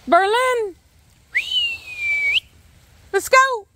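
high, carrying call to a dog. Real, not acted. Owner calling the dog over, high shout. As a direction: summon a dog from across the yard.

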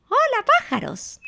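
very high mouse greeting; ratón muy alto. Acted. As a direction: call up to them in a high story voice. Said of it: "ratón muy alto decía". (saludo muy agudo de ratón)

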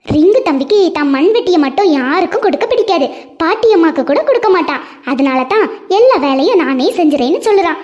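plain reading voice

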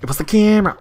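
insistent protest. Real, not acted. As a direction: insist indignantly. Blames camera after a miss.

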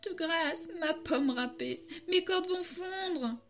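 plus aigu, lent et implorant; supplication paniquée. Acted. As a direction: supplier d'une voix montée et traînante. (supplique aiguë et lente)

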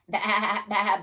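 loud, wavering baa; sheep bleat. A narrator's reading. Teacher reading the sheep's part to children, loud bleating. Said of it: "bleated the sheep".

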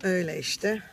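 usual speaking voice

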